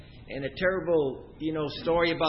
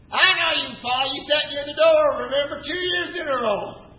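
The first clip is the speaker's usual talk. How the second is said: imitating an old teacher on the phone, higher and louder; exclaimed recognition; 6 semitones higher, 4 dB louder than usual. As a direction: burst in with instant recognition of a former pupil.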